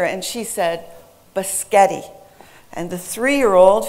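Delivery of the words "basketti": put-on BISCOTTI said as a small child's buh-SKET-ee, first S lost; little one's "Biscotti", stressed hard in the middle; toddler mispronunciation. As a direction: imitate a two-year-old garbling spaghetti. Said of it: "the server asked the two-year-old".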